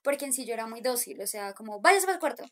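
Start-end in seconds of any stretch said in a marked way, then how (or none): 1.8-2.4 a barked mock order from mom; stern mother order. Mother ordering daughter to her room, stern mock bark. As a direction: snap it like a strict mom sending her kid off.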